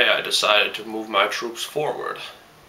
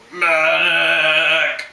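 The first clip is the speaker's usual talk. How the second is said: man down shout; 5 semitones higher, 4 dB louder than usual. Acted. Wounded soldier calling out again.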